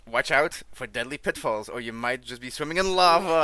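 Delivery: playful gleeful. Acted. Gleeful reading of lava line.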